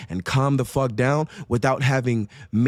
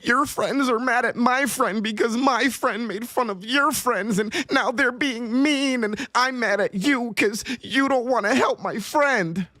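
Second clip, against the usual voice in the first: high, whiny mock child voice, slowing down; mocking whiny little girl; 7 semitones higher than usual. Mocking his friend as a whiny eleven-year-old girl. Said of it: "acting like an eleven-year-old girl".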